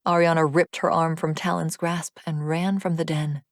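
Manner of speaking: ordinary delivery, nothing marked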